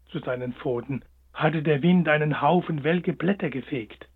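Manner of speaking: ordinary delivery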